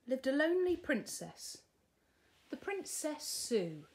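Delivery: ordinary delivery, nothing marked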